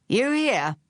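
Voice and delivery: high, snarled challenge; snarling. A narrator's reading. Charles finding Phryne in the hut, snarling. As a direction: snap it out with hostility. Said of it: "snarled the man".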